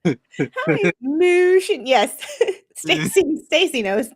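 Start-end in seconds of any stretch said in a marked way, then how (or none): 1.0-1.8 Mooshin drawn out high and loud, oo vowel; mock "Mooshin". Streamer mocking a woman's court pronunciation: high drawled Mooshin. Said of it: "that's a good accent for you".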